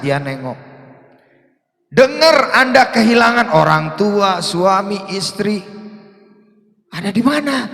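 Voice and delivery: loud, high, impassioned preaching; loud high sermon build; fiery preaching. Acted. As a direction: preach it full force to the congregation. Preaching at full force.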